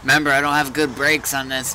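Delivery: usual speaking voice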